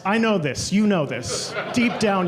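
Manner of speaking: ordinary delivery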